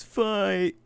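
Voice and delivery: high shouted call of fight; yelling fight. Retold or imitated. Classmate calling out a fight on the bus, high yell.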